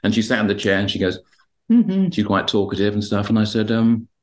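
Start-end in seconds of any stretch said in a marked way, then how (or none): 1.7-2.1 a high, bright little "mm-hmm"; imitating the client. Calm former client answering the therapist, high mock hum. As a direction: mimic her pleased short reply.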